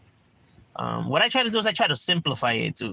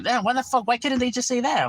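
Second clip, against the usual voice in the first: a higher, mock-baffled buddy voice; imitating the homies; 9 semitones higher than usual. Homies asking why the video is confusing, mock baffled. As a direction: play the confused friend, pitched up.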